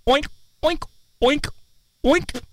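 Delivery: high oinking pig noises; squeaky cartoon pig oinks; pig impression. Shown as a demonstration. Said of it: "I can do that on air".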